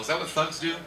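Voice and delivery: ordinary talk, nothing marked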